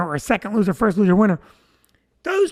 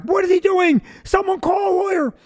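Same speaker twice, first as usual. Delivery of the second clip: mock panic, pitched well up; high, alarmed protest, played for laughs; flipping out; 9 semitones higher than usual. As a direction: imitate outraged older people, high-voiced. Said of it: "you see people flipping out".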